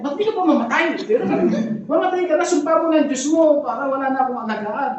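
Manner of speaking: high, loud mocking wife with a laugh; the pastor voicing Job's wife telling him to die; nagging wife. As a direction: sneer it like a scornful wife, half laughing. Job's wife mocked in story.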